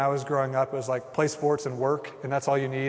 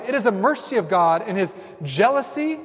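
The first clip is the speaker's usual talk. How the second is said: normal talking voice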